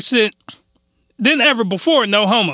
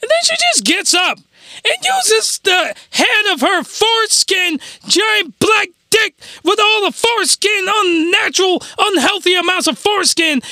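excited; 10 semitones higher than usual. Real, not acted. Excited high retelling of scene.

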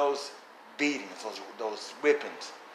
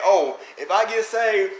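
mocking naive believer; 7 semitones higher, 6 dB louder than usual. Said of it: "And people think".

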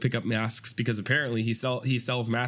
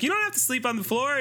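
coy daughter; 12 semitones higher, faster than usual. Retold or imitated. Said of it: "she was like".

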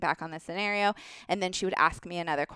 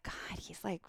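whispered aside; 13 dB quieter than usual. Retold or imitated. Inner thought during tense return.

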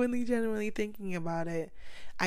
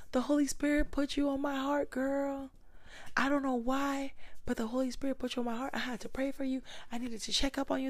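higher, gushing church lady; church friend; 7 semitones higher than usual. Retold or imitated. Church friend telling her she prayed for her, high gushing voice. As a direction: coo like a caring church sister. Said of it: "how many people were like".

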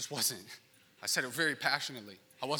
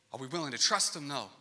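usual speaking voice

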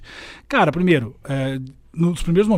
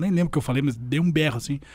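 usual speaking voice

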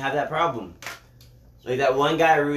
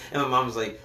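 normal talking voice

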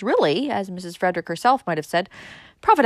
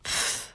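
dismissive puff of air; rude pfft; 7 dB quieter than usual. A narrator's reading. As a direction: blow off the suggestion rudely. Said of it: "said Valancy rudely".

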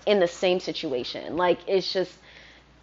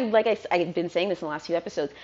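ordinary delivery

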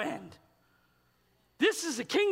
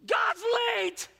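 mock despair; 10 semitones higher than usual. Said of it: "you're probably going".

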